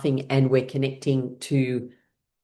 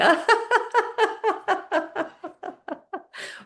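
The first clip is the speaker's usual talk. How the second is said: hearty laugh. Real, not acted. Laughter yoga warm-up.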